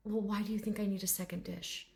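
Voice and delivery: quiet, lightly put-upon dignity; a hint of refined patience; proper gentleman. Dragon answering the water complaint, softly proper. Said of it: "this very proper gentleman".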